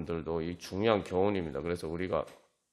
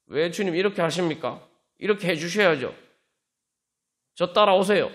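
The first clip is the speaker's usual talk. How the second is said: demanding prayer; 7 semitones higher, 6 dB louder than usual. Retold or imitated. Acted wrong, demanding prayer.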